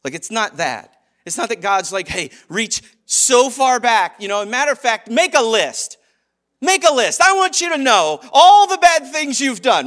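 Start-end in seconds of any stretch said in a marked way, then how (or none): 2.1-4.2 loud, drawn-out demand, pitch lifted; hammed-up divine scolding; mock demanding God. 5.1-9.9 high, loud hammed-up scolding; exaggerated divine tirade; mock demanding God.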